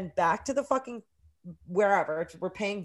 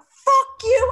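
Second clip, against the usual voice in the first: high, loud retold outburst; defiant yell; 12 dB louder than usual. Arrested student at the police, defiant yell.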